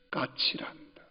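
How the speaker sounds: soft whisper of a loving father; whispered gentle answer. Retold or imitated. Father gently whispers the answer to his little child. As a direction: breathe it gently to a toddler.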